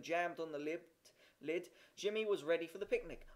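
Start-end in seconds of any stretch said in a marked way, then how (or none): none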